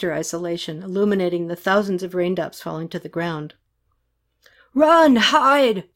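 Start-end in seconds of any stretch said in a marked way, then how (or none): 4.7-5.8 loud, high drawn-out shout; acted urgent yell; yelled warning. A narrator's reading. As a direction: shout a warning from a passing jeep. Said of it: "the driver of a jeep yelled at her".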